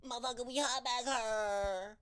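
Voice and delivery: high mock complaint about the heat; woman yelling from the back. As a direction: holler it over and over at the back of a hot bus. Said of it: "repeatedly going".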